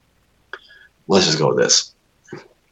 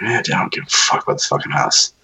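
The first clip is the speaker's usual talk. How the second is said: don't care; 5 semitones higher than usual. Retold or imitated. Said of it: "both man and woman were just like".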